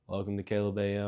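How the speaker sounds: imitating a sluggish show opening; host sign-on with no caffeine in it. Co-host imitating their pre-caffeine show opening, listless. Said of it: "the start of it when we're so obviously".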